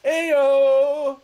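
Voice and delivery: loud, high drawn-out call; big sustained holler; hearty whoop. Real, not acted. Calling out a cheer.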